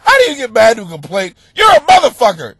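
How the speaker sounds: loud, high mock shout; mock-angry complainer. Outraged listener berating the host over complaints, mock shouting. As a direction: yell a hammed-up accusation.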